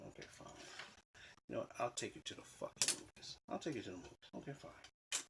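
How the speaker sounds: soft, reluctant concession; grudging agreement. Retold or imitated. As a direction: give in grudgingly, under your breath. Retold grudging agreement to a request.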